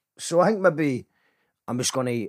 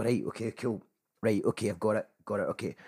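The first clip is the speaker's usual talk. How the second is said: low-key, quiet, offhand; cool and detached; 6 semitones lower, 10 dB quieter than usual. Shown as a demonstration. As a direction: play it cool, underplayed. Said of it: "come in a bit cooler as well".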